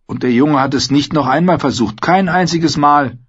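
hohe Frauenstimme, lebhaft erzählend; Ygritte, erzählend. Acted. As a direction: keck und lebhaft, deutlich höher. Ygritte erzählt von früher. (Ygritte erzählt keck von ihrem ersten Mal)

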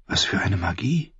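breathless whispered exclamation. Acted. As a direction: whisper it to yourself. Bitter inner exclamation. (geflüstert, fast tonlos)

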